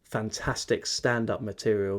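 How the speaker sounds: ordinary talk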